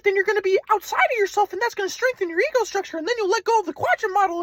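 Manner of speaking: high, mocking grandpa lecturing; grandpa's voice. Son mocking his grandpa's psychoanalysis, high-pitched. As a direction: lift high to mock grandpa's analysis. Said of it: "so he's like".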